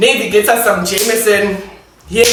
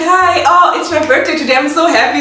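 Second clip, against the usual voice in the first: giddy reply; 7 semitones higher than usual. Retold or imitated. Tipsy birthday girl answers.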